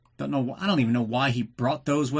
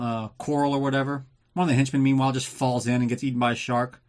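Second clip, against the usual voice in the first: normal talking voice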